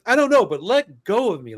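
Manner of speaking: loud, rising protest; half-laughing yell at mom to let go; struggling free while laughing. Retold or imitated.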